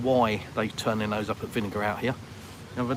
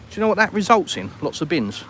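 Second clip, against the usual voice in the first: starting a rant; 6 semitones higher, 5 dB louder than usual. Real, not acted. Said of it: "I'm not gonna go on a rant".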